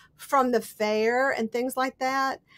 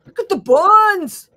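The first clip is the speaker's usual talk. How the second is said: high, loud, drawn-out film-quote cry; Monty Python quote; 6 semitones higher, 11 dB louder, slower than usual. Acted. Quoting the killer rabbit scene to viewers, hammed-up cry. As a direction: exclaim it slowly like a hammed-up movie line.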